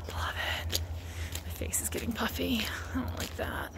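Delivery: soft unvoiced whisper; breathy close whisper to camera. Real, not acted.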